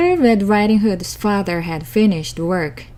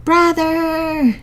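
drawn-out wolf howl of grief; 8 semitones higher than usual. A narrator's reading. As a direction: cry out Brother like a howling wolf. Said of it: "he howled".

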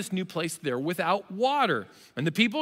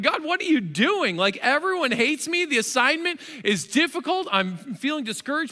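higher, pleading complaint to God; discouraged prayer; 7 semitones higher than usual. Retold or imitated. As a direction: plead like you're at the end of your rope. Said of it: "Moses is probably wondering".

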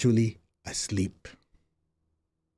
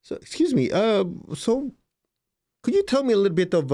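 polite; 8 semitones higher than usual. Retold or imitated. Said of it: "I said".

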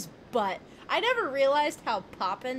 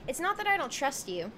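ordinary talk

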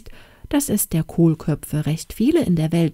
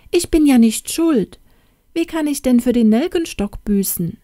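high, louder insistent hen voice; hen protesting; 6 semitones higher, 4 dB louder than usual. Acted. Caught hen protests to the girl, high and insistent. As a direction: plead innocence, rising up.

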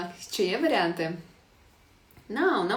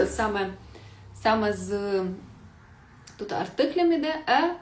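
usual speaking voice

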